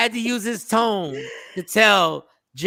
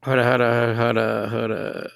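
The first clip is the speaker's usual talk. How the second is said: ordinary talk, nothing marked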